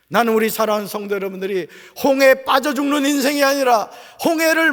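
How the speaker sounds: high, loud pulpit cry; full-force preaching. Real, not acted. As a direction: exhort the congregation at full force.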